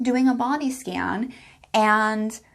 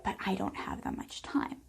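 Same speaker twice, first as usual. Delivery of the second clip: whispered excuse; 2 semitones lower, 12 dB quieter than usual. Retold or imitated. Voicing listener's excuse.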